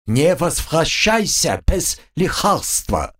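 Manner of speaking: slow, firm high order; stern lisping bug. A narrator's reading. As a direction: slam the order down. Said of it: "припечатал он".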